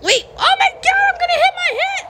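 high-pitched alarmed squeal; playful panic. Pretend. Player about to crash, playful panic. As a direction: squeal as impact looms.

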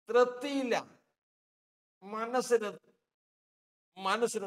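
തൃപ്തിയില്ല cried out high and loud; cry out. Acted. തൃപ്തിയില്ല burst out loud and high. Preacher repeats, soft then cry out.

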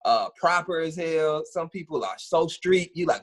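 normal talking voice

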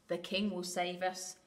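plain reading voice

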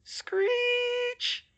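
very high sustained screech; thin, high-pitched shriek; piercing screech. A narrator's reading. Screech read out as a sound.

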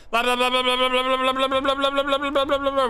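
high, sustained nonstop babble; constant screaming. Pretend. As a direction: keep up an unbroken high noise. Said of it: "the constant screaming".

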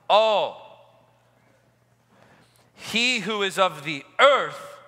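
very slow, each phrase spaced and stressed. Shown as a demonstration. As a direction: hammer above all home, word by word. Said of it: "Let me say that again".

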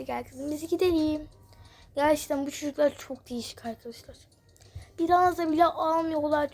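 normal talking voice

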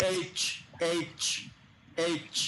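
"এইচ" as the letter name, ending in a crisp ch; letter-name demo. Shown as a demonstration. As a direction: say "এইচ" as aitch, one at a time. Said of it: "sound না, letter হিসেবে". ("এইচ" অক্ষরের নাম হিসেবে, শেষে চ স্পষ্ট করে)

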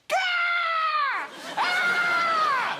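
very high, loud shrieking fox cry; angry fox screech. Acted. Fox outside at night screeching at the house, shrill yell. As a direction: screech it like a furious fox in the bins.

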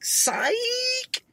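very high squeaky sing-song psych; kid's teasing taunt, high; playground taunt. Shown as a demonstration. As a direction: squeal it like a mocking kid. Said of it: "demoing the slang word".

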